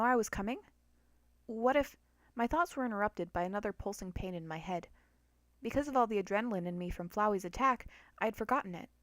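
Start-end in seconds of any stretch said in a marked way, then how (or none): none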